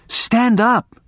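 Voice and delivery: high-pitched plea; imploring woman, acted by the narrator; pleading. A narrator's reading. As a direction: beg him in a lifted voice. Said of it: "she pleaded".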